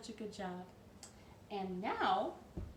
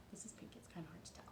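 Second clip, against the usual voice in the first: quick whisper to the side; whispered aside; 3 semitones lower, 14 dB quieter, much faster than usual. Real, not acted. Storyteller aside to the kids, a quick whisper. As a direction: breathe the aside fast and low. Aside to viewers about colour.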